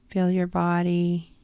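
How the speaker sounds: plain reading voice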